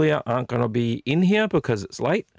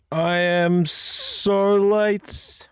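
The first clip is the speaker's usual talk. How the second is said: drawn-out, higher, hammed-up moan; mock lament; 7 semitones higher, much slower than usual. Host moaning to viewers about being late, slow and hammed-up. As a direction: stretch every word like a tragedy.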